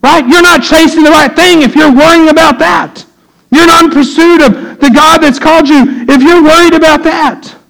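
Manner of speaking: high, driving sermon voice; full-force preaching. Real, not acted. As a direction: press it home from the pulpit.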